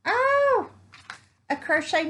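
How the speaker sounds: high loud oh, then bright delight; excited squeal. Real, not acted. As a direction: squeal at the find. Finds the mermaid tail pattern.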